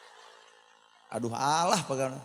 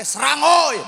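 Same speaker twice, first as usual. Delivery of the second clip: fight cry; 12 semitones higher, 11 dB louder, slower than usual. Acted. Villagers' fight call in story.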